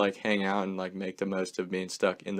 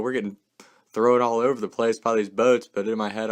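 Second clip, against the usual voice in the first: ordinary talk, nothing marked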